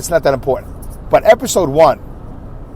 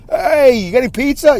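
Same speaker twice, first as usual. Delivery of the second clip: high, called-out "Hey" across a wide avenue; hollering to a friend across the street; yelling across the street; 7 semitones higher than usual. Retold or imitated. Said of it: "You would yell".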